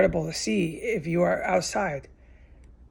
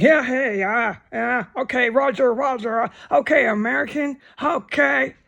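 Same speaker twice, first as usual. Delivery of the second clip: higher, mocking radio-style yells; mock neighbor chatter; 5 semitones higher than usual. Mocking the backyard guys saying roger, higher mocking yells. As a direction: parody their cocky backyard talk. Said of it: "they were just talking like".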